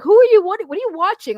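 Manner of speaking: high incredulous husband; loud disbelieving husband. Retold or imitated. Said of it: "they'd be like".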